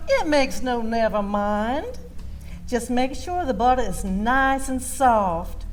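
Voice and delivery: plain reading voice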